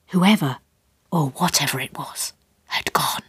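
whispered narration, unvoiced; hushed. A narrator's reading. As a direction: breathe the words out in suspense. Narration drops to hush.

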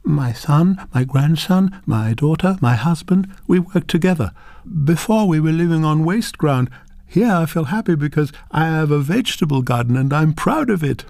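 put-on a proud old woman naming her family; firm. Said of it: "the voice now firm".

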